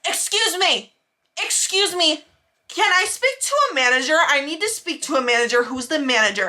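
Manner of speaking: mock an irate demand, starting high and settling; retold yelling. Customer flagging down a waitress, mock yelling. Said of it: "was, like, yelling at her".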